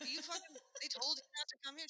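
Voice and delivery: high, loud mock disbelief; incredulous retort. Challenging the British tourist, in mock incredulity. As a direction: throw it back as an appalled question.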